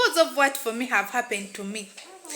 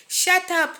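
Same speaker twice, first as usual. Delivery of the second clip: raised, louder pitch for a retold scolding; dad's sharp order; 8 semitones higher, 5 dB louder than usual. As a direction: imitate dad cutting off the shouting. Dad's retold angry order from outside.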